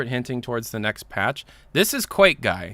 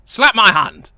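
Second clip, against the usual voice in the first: trying out a French accent, loud; Spy; 10 semitones higher, 13 dB louder than usual. Shown as a demonstration. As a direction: test a hammed-up French villain line. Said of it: "what does Spy sound like?".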